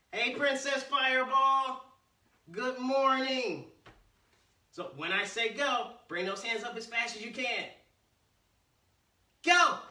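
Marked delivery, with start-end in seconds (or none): 0.1-3.7 slow, loud, bright-voiced hello; cheerful greeting. Real, not acted. Greeting a viewer in chat. 9.4-9.8 loud high shout of go; shouted command. Real, not acted. Martial arts command.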